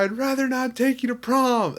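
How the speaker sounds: excited. Retold or imitated. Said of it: "and I go".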